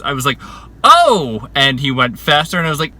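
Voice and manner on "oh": loud, high "oh" of discovery; sudden realisation. Retold or imitated. Rider retelling her reaction to the trainer's tip, surprised realisation. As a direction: re-enact the moment it suddenly clicks.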